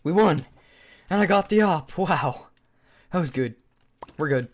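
loud, high victory cheer; excited. Real, not acted. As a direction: exclaim it, thrilled at the win. Wins the round with op.

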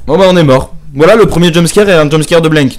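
loud, high burst after a jumpscare; panicking gamer. Real, not acted. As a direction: shout it out right after the scare. Just died to jumpscare. (joueur paniqué qui s'exclame fort)

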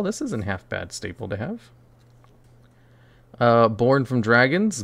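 usual speaking voice

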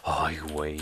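low, soft manly voice; macho voice. Retold or imitated. Streamer on a suddenly manly alert voice, low macho tone. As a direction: drop deep like a macho. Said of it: "una pinche voz de macho que dices".

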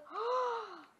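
a high, sudden gasp of delight; sharp delighted gasp. Real, not acted. Gamer spotting a baby wolf, sharp delighted gasp. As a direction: gasp like you just spotted something adorable.

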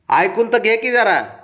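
high, loud, drawn-out plea; insistent high-pitched appeal; exasperated pleading. Real, not acted. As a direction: plead loudly to be heard out, stretching it. Pleading with sulking pet to listen.

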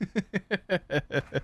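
high cackling laugh; gleeful laugh. Real, not acted. Streamer after a kill, high gleeful cackle.

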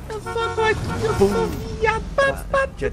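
very high, slow, hammy falsetto; silly falsetto. Pretend. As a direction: squeal it out like a cartoon. Clowning in car after prank.

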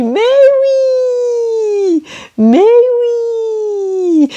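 high "oui" held on a long "ee". Shown as a demonstration. Teacher reminds learners of practised words, long high E in "oui". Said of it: "practiced them with E in them".

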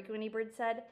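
usual speaking voice

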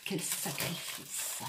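whispered mock lament, slow. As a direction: breathe it like a tragic sacrifice. Mock lament about kneeling.

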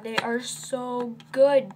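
ordinary talk

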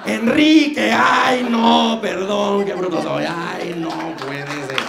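high, loud flustered shout, slowing down; shrieking mock self-correction. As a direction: yell the slip, then fake the embarrassment. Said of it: "la riega siempre".

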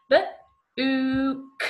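B-ook split into b and a short oo; sounded-out book. Shown as a demonstration. Teacher sounds out B-ook for children to blend. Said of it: "That's the one I've just said".

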